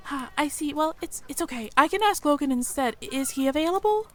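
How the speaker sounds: high, timid little mouse voice; a nervous young mouse working up courage; shy mouse girl. Acted. Mia the mouse approaching the human stranger, timid and high. Mia, disappointed, asks about Loken.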